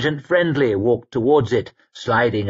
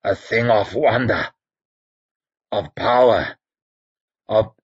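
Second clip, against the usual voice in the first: croaking priest. Acted. Dying Werdenur speaks.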